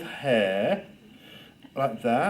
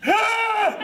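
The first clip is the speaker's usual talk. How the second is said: high loud gasp of shock; exaggerated shocked gasp; 4 dB louder than usual. Shown as a demonstration. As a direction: gasp as if the face is magnified. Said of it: "I'm gonna show you a ten out of ten for shocked".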